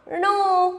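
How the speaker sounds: teacher's model णो with a stretched "oh"; long O sound. Shown as a demonstration. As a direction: draw out णो as retroflex "nohh". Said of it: "तो इसका उच्चारण निकलेगा".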